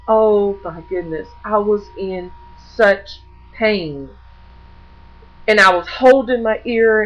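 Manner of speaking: ordinary delivery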